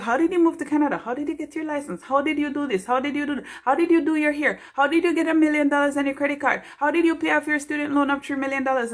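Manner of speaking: high, excited rapid-fire questions; imitating people peppering her with questions; mock amazed questions. Voicing admirers quizzing her, high and eager. People asking how she did it.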